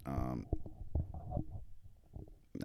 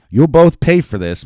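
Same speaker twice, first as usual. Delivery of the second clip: loud, raised mock threat; threatening promise; 8 semitones higher, 11 dB louder than usual. A narrator's reading. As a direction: vow payback at full voice. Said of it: "Muller promised to Marks and Mowry".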